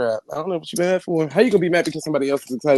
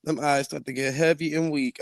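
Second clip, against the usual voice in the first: normal talking voice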